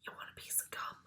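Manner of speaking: hushed unvoiced whisper. A narrator's reading. Classmate whispering to the boy, a hushed whisper. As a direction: whisper it secretly across the desk. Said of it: "she whispered in my ear".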